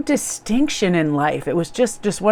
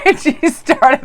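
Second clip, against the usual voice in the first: louder, slowed words through a laugh; 8 semitones higher, 5 dB louder, slower than usual. Real, not acted. Vlogger recalling the joke with her manicurist, laughing as she talks. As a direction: tell it while giggling at the memory.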